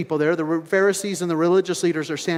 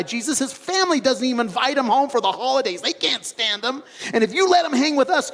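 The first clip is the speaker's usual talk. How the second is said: higher, whiny protest against the traitor; protesting disciples; 6 semitones higher than usual. Retold or imitated. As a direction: plead with Jesus, scandalized. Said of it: "they're like".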